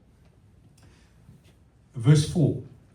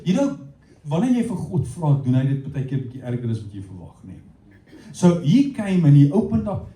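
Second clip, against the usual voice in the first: ordinary delivery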